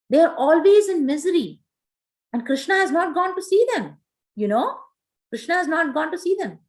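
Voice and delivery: retold distressed protest. Mother Rohini protests to Uddhava about the gopis' misery, mildly distressed.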